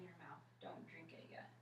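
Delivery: soft whispered instruction; whispering. Real, not acted. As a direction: whisper the warning gently. Quiet instruction to patient.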